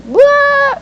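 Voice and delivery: high, loud mock wail; a comic burst of movie-style yelling. Quitting like Jerry Maguire, high loud mock wail. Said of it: "Jerry Maguire moment ... All the yelling and the screaming".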